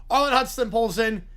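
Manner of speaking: ordinary delivery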